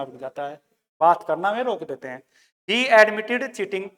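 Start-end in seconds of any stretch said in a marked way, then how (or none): 2.7-3.8 slow dictation of the sentence. Shown as a demonstration. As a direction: spell it out slowly. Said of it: "dictating example sentence".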